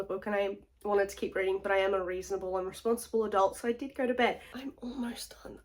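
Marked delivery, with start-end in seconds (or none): 4.5-5.6 soft secret whisper. Real, not acted. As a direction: whisper it conspiratorially. Whispered aside.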